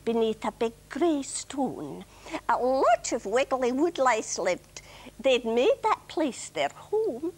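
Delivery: put-on Scottish-accented children's verse. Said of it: "say this in a Scottish accent".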